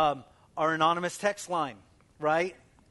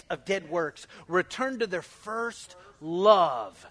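loud, fervent preaching; 3 semitones higher, 7 dB louder than usual. Acted. Pastor preaching loudly to his congregation, fervent. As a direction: preach it with full force to the church.

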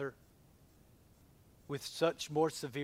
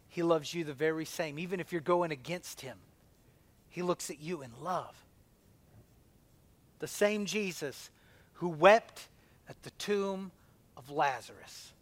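normal talking voice

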